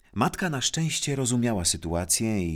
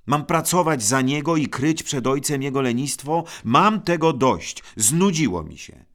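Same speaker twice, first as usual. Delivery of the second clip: higher, louder angry complaint, slowing at the end; indignant outburst; 7 semitones higher, 7 dB louder than usual. A narrator's reading. Brother shouts to his mother, fed up with covering. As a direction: vent that he has had enough.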